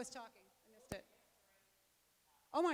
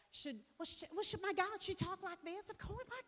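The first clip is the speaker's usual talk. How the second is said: high-pitched put-on Southern accent; hammed-up dumb Southern accent; 9 semitones higher, 5 dB louder than usual. Shown as a demonstration. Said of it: "I love doing dumb accents".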